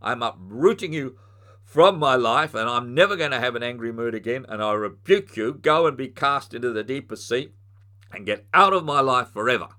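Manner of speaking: loud, forceful command, peaking on "from my life"; commanding rebuke. Shown as a demonstration. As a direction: rebuke the anger like casting out a spirit. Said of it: "you speak to your angry mood and you say".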